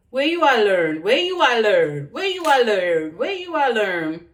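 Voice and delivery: loud patois question in Jamaican accent; loud Jamaican Creole line. Shown as a demonstration. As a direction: ask it in broad Jamaican patois. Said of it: "let's get back into the patois".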